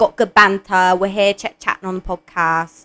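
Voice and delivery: chatty, slangy British accent. Shown as a demonstration. Friend doing an accent on request for the podcast, British accent. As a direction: put on a casual British reality-show accent. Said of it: "I'll do a British one".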